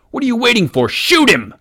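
high, loud frantic yell. Acted. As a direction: scream at the gang to shoot. Robber yells at compatriots.

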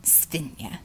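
scornful Russian mutter; contemptuous aside. A narrator's reading. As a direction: slip the insult under your breath. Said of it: "looked down her nose".

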